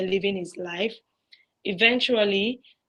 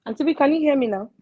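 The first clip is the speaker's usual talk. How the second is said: higher, quick, slightly raised voice; checking the line; 6 semitones higher, 4 dB louder, faster than usual. Real, not acted. Caller back on air asks Auntie B, raised voice. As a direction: call out quickly to test the line.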